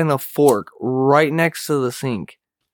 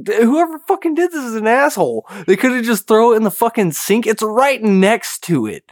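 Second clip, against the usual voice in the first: annoyed rant; 8 semitones higher, 3 dB louder than usual. Retold or imitated. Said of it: "I was like".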